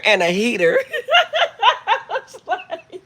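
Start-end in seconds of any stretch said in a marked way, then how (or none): 1.9-3.0 high squeal then slow words through laughter; laughing. Real, not acted. Laughing fit.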